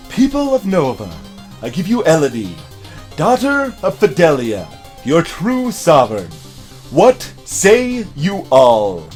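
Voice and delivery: herald proclamation. Acted. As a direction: proclaim to the whole court. Presents the queen to people.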